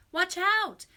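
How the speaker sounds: high, loud warning cry; cried warning. A narrator's reading. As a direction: shout an urgent warning. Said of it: "Dad cried".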